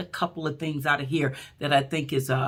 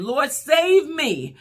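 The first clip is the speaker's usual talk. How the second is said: Peter's plea; 9 semitones higher, 4 dB louder, much slower than usual. Retold or imitated. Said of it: "like Peter did".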